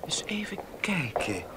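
whispered to himself as if thinking it over; teasing whispered aside; mock pondering whisper. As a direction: breathe it out like a private calculation. [name] pretends to think.

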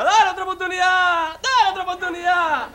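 crowd yelling. Acted. Whole family begs the executioner once he fails, yelling high. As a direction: yell as a whole family begging. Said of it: "dice la familia entera".

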